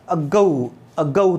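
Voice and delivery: "अगऊ" as short अ, then गऊ; teacher sounding out "अगऊ"; syllable by syllable. Shown as a demonstration. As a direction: show two beats in "अगऊ". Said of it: "एक तो हो गया ये ए".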